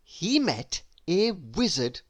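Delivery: usual speaking voice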